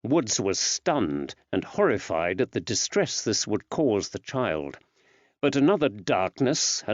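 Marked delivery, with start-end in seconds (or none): none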